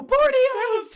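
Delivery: high, loud excited Spanish. Retold or imitated. Costa Rica girls teaching Spanish, high and gushing. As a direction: gush it like thrilled fans. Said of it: "they go".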